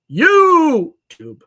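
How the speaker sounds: a loud, high yell of "YouTube"; all caps shout. Acted. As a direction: shout it like it's in caps lock. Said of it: "I just acted it out".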